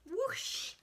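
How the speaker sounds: high playful whoosh of a blowhole; mock blowhole spray; whoosh sound. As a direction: spout like a whale. Imitating whale blowhole.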